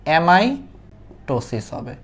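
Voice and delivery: Amitosis very slow, split by a pause after অ্যামাই; slow teacherly dictation of amitosis, broken before ptosis; drawn-out key term. Shown as a demonstration. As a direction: stretch amitosis out in two parts for the class.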